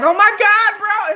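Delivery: mock fangirl. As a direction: gush it like a starstruck fan. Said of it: "these bitches was like".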